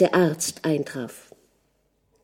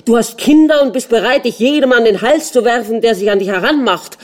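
fast, high, loud tirade; furious shouting; 5 semitones higher, 6 dB louder, faster than usual. A narrator's reading. Jealous husband accusing wife of flirting, shouting furiously. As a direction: hurl the accusation at full pace. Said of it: "schrie der Fürst weiter".

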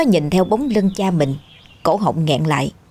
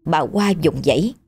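ordinary delivery, nothing marked

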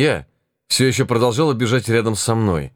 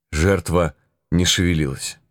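usual speaking voice

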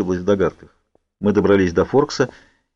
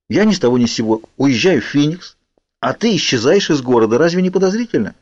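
higher-pitched, indignant arguing; 6 semitones higher than usual. A narrator's reading. Girl arguing that their leaving looks suspicious, indignant. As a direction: argue it, pitch climbing on the question. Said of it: "возмутилась я".

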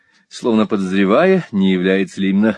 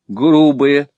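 higher, louder echo of the word; raised-pitch protesting outburst; indignant exclamation; 5 semitones higher, 5 dB louder than usual. A narrator's reading. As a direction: exclaim it back in mild outrage. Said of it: "воскликнула мисс Лакриви".

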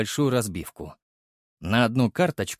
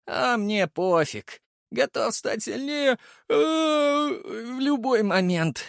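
slow, high drawn-out yawn; 12 semitones higher, slower than usual. Acted. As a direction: talk through a big yawn. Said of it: "зевнув".

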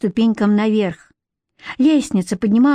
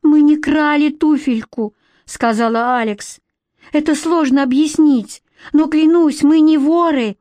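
higher, louder earnest plea; pleading; 6 semitones higher, 3 dB louder than usual. A narrator's reading. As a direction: beg her to believe you. Pleading innocence.

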